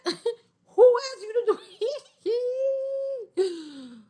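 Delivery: high, laughing mock denial; laughing high-pitched mocking impression; mock lean-back voice. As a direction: squeal it out like a guy dodging blame. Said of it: "they gotta lean back like".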